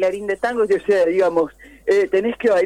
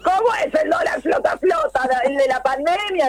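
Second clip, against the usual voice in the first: excited shouting; 4 semitones higher than usual. Real, not acted. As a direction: rant and yell the question. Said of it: "cálmenla".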